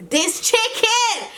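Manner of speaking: high, drawn-out mock rave; hammed-up squeal of food praise; imitating mom. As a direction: stretch it way up high in exaggerated delight. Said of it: "she was like".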